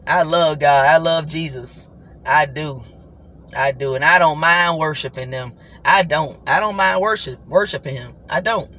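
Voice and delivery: ordinary talk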